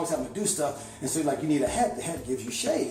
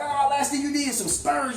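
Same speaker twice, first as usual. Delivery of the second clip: much higher, mocking sing-song taunt; teasing mock; 12 semitones higher, 4 dB louder than usual. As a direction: rib him about the spurs, half laughing. Homeboys teasing his cowboy gear.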